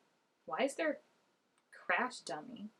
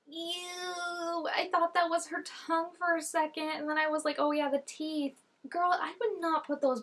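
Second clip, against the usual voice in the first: grossed-out exclamation; 8 semitones higher than usual. Real, not acted. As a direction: squeal at the gross sight. Grossed out by teeth.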